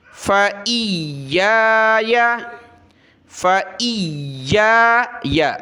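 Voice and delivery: फ़इय्याया with the या doubled and drawn out; stretched doubled ya. Shown as a demonstration. Teacher shows students the drawn-out ya. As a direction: lean on the doubled या in फ़इय्याया and hold it. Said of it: "इस या को खींचा भी, सख्त भी किया".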